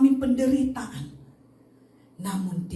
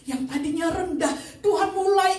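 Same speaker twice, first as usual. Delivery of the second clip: high, loud, soaring testimony; full-force preaching; 12 semitones higher, 5 dB louder than usual. Acted. Preacher proclaiming inner healing to congregation, full-force preaching. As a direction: drive the climax home with fervour.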